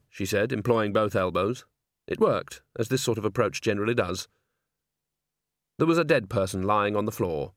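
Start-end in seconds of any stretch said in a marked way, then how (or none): none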